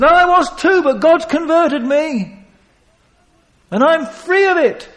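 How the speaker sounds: high, loud joyful testimony. Acted. Peter testifying to the crowd, high and loud. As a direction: proclaim freedom.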